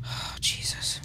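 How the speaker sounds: breathless frightened whisper; scared whisper. Real, not acted. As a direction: whisper it in dread. Scared whisper in dark game.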